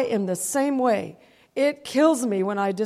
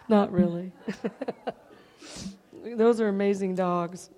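ordinary delivery

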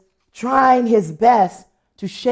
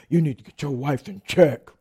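lightly voiced stern man, pitched lower; exasperated man; 6 semitones lower than usual. Fed-up man complaining to her husband, lightly imitated stern voice.

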